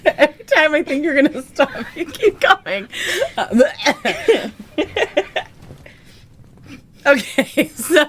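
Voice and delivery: very high, laughing through words; laughing hard. Real, not acted. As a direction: crack up while teasing.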